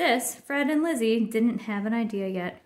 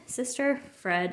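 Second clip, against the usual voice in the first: plain reading voice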